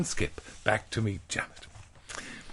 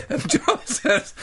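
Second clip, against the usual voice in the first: narration broken up by real laughter; laughing while reading; 4 dB louder, slower than usual. Real, not acted. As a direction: try to read through the giggles. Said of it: "How did this characterization creep in?".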